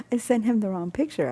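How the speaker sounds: ordinary talk, nothing marked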